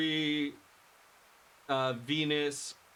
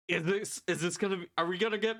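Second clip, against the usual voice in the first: normal talking voice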